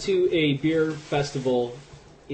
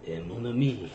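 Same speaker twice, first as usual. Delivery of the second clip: Gambit voice; 4 semitones lower, 5 dB quieter than usual. Retold or imitated. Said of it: "bad voice for Gambit".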